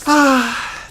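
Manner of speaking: ordinary talk, nothing marked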